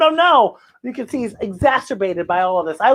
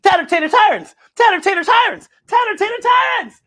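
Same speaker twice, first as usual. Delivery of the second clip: rising ever higher, to a shout; tongue twister; 12 semitones higher, 4 dB louder than usual. Shown as a demonstration. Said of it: "Try saying that three times fast".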